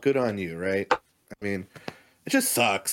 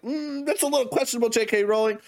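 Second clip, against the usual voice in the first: mocking voice, pitched well higher; a prissy fan, faking concern; mock fan critic; 9 semitones higher than usual. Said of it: "you know, like".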